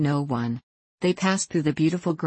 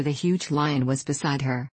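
plain reading voice

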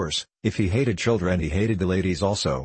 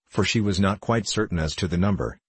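ordinary delivery, nothing marked

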